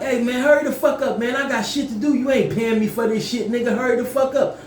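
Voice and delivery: high-pitched, impatient acted rant; going off. Storyteller at the bathroom door rushing his friend, acted tirade. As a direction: go off on someone who is holding you up.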